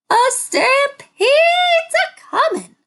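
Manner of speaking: high, loud chorus of alarm. A narrator's reading. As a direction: sound the alarm together. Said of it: "chorused the trio".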